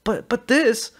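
high, loud sputtering indignation; mock outrage. A narrator's reading. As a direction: ham up a shocked protest. Officer mocks outrage loudly.

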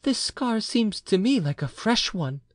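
high wife's voice, surprised and uneasy; alarmed surprise. A narrator's reading. Wife notices her husband's fresh scar, surprised and alarmed. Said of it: "with surprise not free from alarm".

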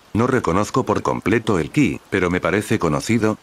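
ordinary delivery, nothing marked

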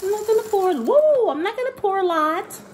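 high, childlike pretend voice; seven-year-old self. Pouring cereal for viewers, pretend seven-year-old voice. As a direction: pitch it way up like a kid. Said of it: "transitioning to my seven-year-old self".

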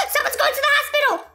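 high, quick delighted announcement; high fast thrilled call; excited gasp and exclaim. Real, not acted. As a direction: gasp then blurt it out. Spots patient in game.

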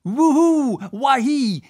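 shrieked rowing whoop; screamed war whoop. A narrator's reading. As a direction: whoop wildly to the skies.

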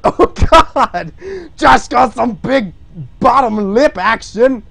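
loud delighted laughing outburst; excited laugh. Real, not acted. Streamer cracking up at the drawn lip. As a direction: crow over the big lip.

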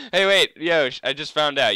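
loud excited call to a friend. Real, not acted. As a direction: shout to get Yosh's attention. Calling to teammate Yosh.

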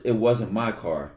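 normal talking voice

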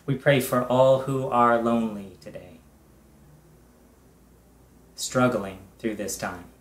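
usual speaking voice

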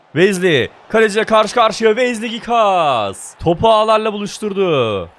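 loud, high excited commentator call; goal commentary. Acted. Gamer commentating his team's goal, excited.

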